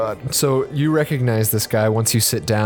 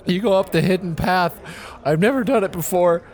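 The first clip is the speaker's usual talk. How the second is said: suara tinggi kurcaci polos yang menangis; dwarf naif yang tersedu, nada tinggi; Sandwich Ralph, tearful; 7 semitones higher than usual. Acted. GM voices Ralph giving directions.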